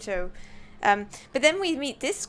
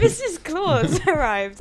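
laughing; 6 semitones higher, 7 dB louder, slower than usual. Real, not acted. Cracking up announcing Mrs Claus.

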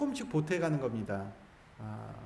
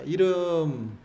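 long drawn-out, louder prompt; loud cue; 7 dB louder, much slower than usual. Shown as a demonstration. Monk cueing everyone to shout their own name, loud drawn-out call. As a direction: stretch it out to cue the crowd. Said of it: "큰 소리로 한번 불러볼게요".

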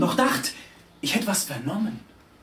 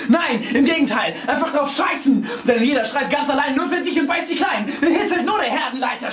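high-pitched, driving, breathless-sounding recitation; frenzied verse recital; 11 semitones higher, 4 dB louder than usual. A narrator's reading. Narrator swept along by screeching riders, frenzied recital. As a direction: ride the rhymes like a stampede.

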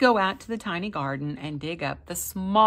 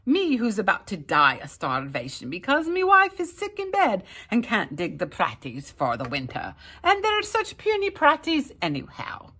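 louder, high complaining Irishman; self-pitying complaint; 4 semitones higher, 6 dB louder than usual. A narrator's reading. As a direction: grumble about his lot. Jamie complaining to leprechaun.

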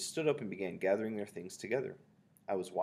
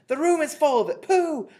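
very high, loud disgusted cries; shouted; 19 dB louder, much slower than usual. A narrator's reading. Witches crying out at the smell, shouted. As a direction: build to a long high howl of "pooh". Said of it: "they shouted".

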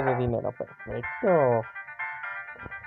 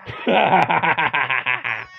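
menacing laugh; 4 dB louder than usual. Acted. Laugh after a threat.

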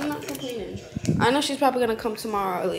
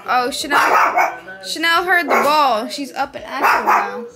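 excited dog talk; 2 semitones higher, 9 dB louder, slower than usual. Real, not acted. Owner sees Chanel is up and active, excited. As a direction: exclaim as the dog perks up.

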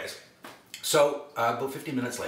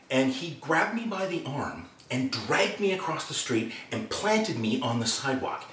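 ordinary delivery

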